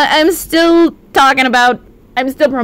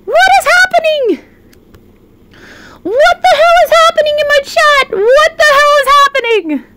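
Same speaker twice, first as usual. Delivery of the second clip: high-pitched disbelief; 9 semitones higher than usual. Real, not acted. Streamer squealing at chat for gifting subs.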